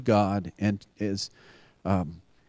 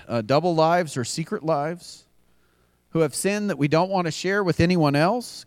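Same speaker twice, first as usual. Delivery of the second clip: louder, pressing preacher build; rising sermon appeal; 4 semitones higher, 4 dB louder than usual. Acted. Pastor urging the congregation, building preaching. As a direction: lift it like an altar call.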